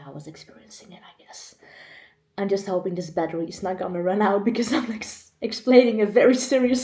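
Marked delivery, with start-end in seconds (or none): 0.0-1.6 fast, voiceless whisper to herself; whispered aside. Real, not acted. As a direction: drop to a whisper and hurry through. Trails into an aside.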